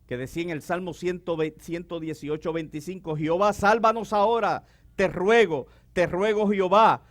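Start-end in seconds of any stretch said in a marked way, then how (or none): 3.2-7.0 raised, pleading psalm read, louder; preacher pleading the psalm aloud; fervent scripture reading. Acted. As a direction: proclaim the psalm as an urgent plea. Reads psalm as plea.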